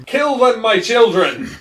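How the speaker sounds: high, loud theatrical cry; hammed-up battle order; villainous command. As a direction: command a swarm of minions. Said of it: "I was just about to say".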